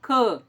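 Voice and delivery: Cã said alone and loud, nasal closed A. Shown as a demonstration. Teacher isolating the stressed syllable, loud closed Cã. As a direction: punch out the syllable Cã on its own. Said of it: "Esse A, ele é fechado".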